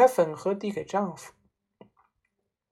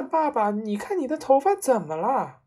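raised pitch, falling at the end; milder shriek than a full scream; alarmed boyish cry; 6 semitones higher, 4 dB louder than usual. A narrator's reading. Son cries out to his father about the hair, alarmed. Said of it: "儿子也叫起来".

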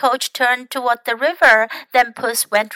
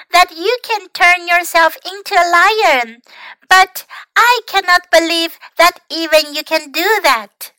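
putting on bravery; 7 semitones higher, 5 dB louder than usual. A narrator's reading. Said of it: "looking far braver than he felt".

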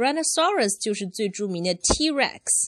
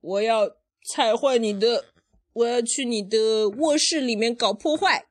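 lightly voiced brother's threat, raised and louder; lively mock threat; Rex; 5 semitones higher, 4 dB louder than usual. Parent retelling the brother's threat, lightly acted. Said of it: "Rex 说".

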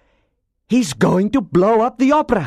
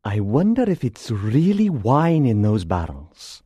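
musing, swooping character voice; thoughtful. A narrator's reading. Persian doubts the barrels hold wine, thoughtful. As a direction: wonder aloud with suspicion. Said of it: "said the Persian thoughtfully".

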